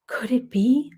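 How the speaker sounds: gasp, then a breathless whispered question. Acted. Girl spotting a sand dollar, whispering in wonder. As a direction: hush it in wonder.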